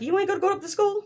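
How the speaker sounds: high, exasperated disbelief at the dad; incredulous. Retold or imitated. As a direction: scold him in high outrage, half laughing. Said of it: "I'm like".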